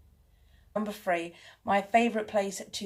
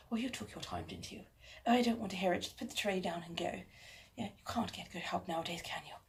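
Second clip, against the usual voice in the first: softer, mock-snooty lady scolding the servant; hammed-up posh snob, softer; stuck-up posh; 8 dB quieter, faster than usual. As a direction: play a snob looking down on her servant. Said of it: "that's my intake on someone incredibly posh and stuck up".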